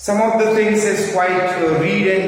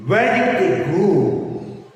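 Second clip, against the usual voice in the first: "Where did they go" slowed, with "Where" emphasised; sentence stress demo; slower than usual. Shown as a demonstration. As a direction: model the question's stressed words slowly. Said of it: "where you have to stress".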